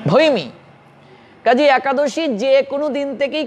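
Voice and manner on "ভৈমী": ভৈমী said alone, "bhoi-mee"; correct pronunciation ভৈমী. Shown as a demonstration. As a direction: isolate ভৈমী as two syllables, bhoi then mee. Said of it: "এটার শুদ্ধ উচ্চারণ". (ভৈমী, আলাদা করে শুদ্ধ উচ্চারণ)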